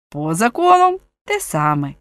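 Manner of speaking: usual speaking voice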